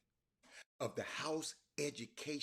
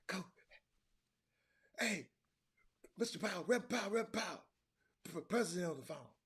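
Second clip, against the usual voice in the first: put-on high, loud little boy calling upstairs; 7 semitones higher, 3 dB louder than usual. Little boy calls up to Powell about the phone. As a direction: call up like a small boy.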